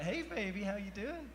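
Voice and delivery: high, soft mock come-on; flirty woman imitation. Homeless lady coming on to him, mock flirty.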